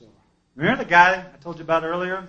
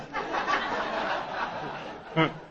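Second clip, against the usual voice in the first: breathy laugh; 5 dB quieter than usual. Real, not acted. Laughing at himself.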